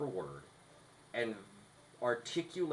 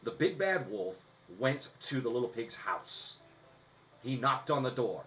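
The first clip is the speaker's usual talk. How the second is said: louder, lively storybook read with punch; an energized story read set against the dull take; energetic read; 3 semitones higher, 5 dB louder than usual. Shown as a demonstration. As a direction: put some spit on it, full energy. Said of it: "put some energy behind that".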